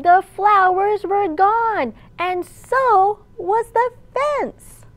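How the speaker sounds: lively picture-book storytime voice; reading to children. A narrator's reading. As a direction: read it aloud to a room of little kids.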